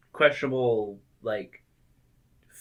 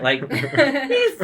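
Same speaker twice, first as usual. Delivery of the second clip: ordinary delivery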